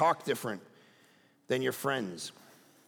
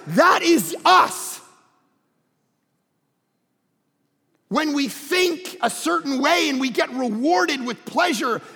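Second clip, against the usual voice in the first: forceful, driving sermon with punched key words; preaching; 5 semitones higher, 4 dB louder than usual. Real, not acted. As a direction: hammer the point home to the congregation.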